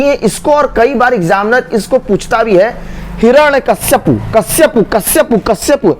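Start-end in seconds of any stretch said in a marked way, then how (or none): 3.2-5.9 हिरण्यकश्यपु ending in kash-ya-pu; common mispronunciation. Shown as a demonstration. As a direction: repeat कश्यपु briskly with a 'shya' cluster. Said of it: "इतनी बार ये उच्चारण किया जाता है". (हिरण्यकश्यपु, गलत 'श्य' वाला उच्चारण)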